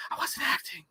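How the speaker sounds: breathy gravel whisper, like a tough-guy cowboy; Clint Eastwood whisper. Retold or imitated. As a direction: hush it out like an old gunslinger. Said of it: "You whisper it like fucking Clint Eastwood".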